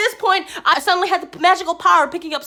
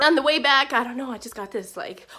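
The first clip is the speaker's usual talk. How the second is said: ordinary talk, nothing marked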